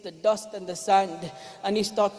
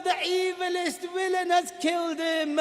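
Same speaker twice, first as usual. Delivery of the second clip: loud chanted lament, high; loud sung lament of mourning; proclaiming shout; 4 semitones higher, 4 dB louder than usual. Acted. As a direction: cry it out to the whole city. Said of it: "Jibreel starts shouting".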